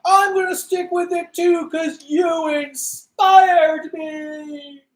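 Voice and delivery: high, loud, drawn-out excited cry; gushing mock shout. Acting out an inspired character's declaration, gushing. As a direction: belt it out in over-the-top inspiration.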